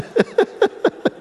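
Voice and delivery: high-pitched burst of laughter; real laughter. Real, not acted. Teacher joking about being that relaxed, real laughter.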